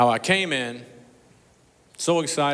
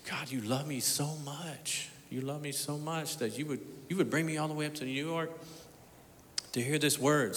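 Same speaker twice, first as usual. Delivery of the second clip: hushed prayer; 3 semitones higher, 7 dB quieter than usual. Retold or imitated. Kneeling outside the restaurant, he whispers thanks to God, softly. Overwhelmed prayer after prophecy.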